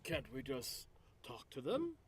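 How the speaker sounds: tentative pleading in a put-on voice; hesitant peacemaker. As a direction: gently object to the fight. Player voices own character.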